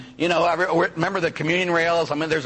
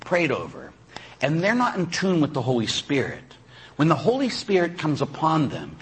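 ordinary delivery

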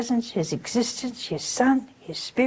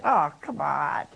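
retold disbelief; 6 semitones lower, slower than usual. Scoffs at a minister's explanation.

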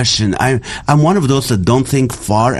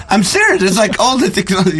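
laughing; 8 semitones higher, faster than usual. Real, not acted. Laughing at own joke.

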